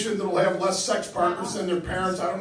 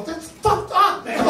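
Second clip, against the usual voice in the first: indignant; 8 semitones higher, slower than usual. Retold or imitated. Told his testicles are large.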